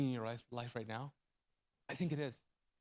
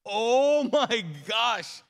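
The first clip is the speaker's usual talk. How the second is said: son's dawning shock; 6 semitones higher, 8 dB louder, much slower than usual. Retold or imitated. Son's realization of burn pain.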